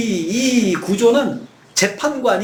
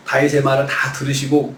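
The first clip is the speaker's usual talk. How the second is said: usual speaking voice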